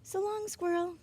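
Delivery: put-on high, friendly kid farewell; high cheerful goodbye. A narrator's reading. Charles says goodbye to the squirrel, high and cheerful. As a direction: wave it off brightly up high.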